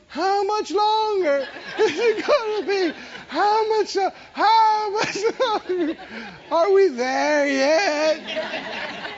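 put-on high, drawn-out whine of kids in the back seat; whining kids. Kids in the back seat whining at parents, high and drawn out. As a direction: whine like a bored child on a car trip. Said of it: "And whining".

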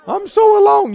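high, loud whining wail; mock whine. Preacher parodies a believer moaning that they are alone. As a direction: ham up a crybaby's moan. Mocking self-pity quote.

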